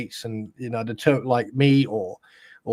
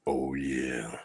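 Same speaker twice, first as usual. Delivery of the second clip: breathy low whisper, showing off; sexy whisper; 11 semitones lower, 10 dB quieter than usual. Pretend. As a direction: purr it in a hushed sexy voice. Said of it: "you've got a sexy voice like I have".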